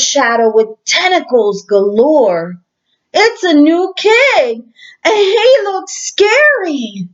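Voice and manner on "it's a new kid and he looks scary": scared cry. Acted. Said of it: "cried Joey Mackerel".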